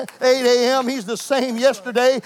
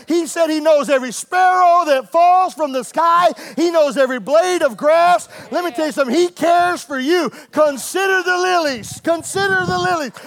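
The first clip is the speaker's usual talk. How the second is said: preaching at full force; 5 semitones higher than usual. Acted. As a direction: build the congregation up. Preaching climax.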